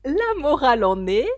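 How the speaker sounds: a lofty duchess pronouncing her moral. Acted. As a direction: lecture Alice like a pompous duchess.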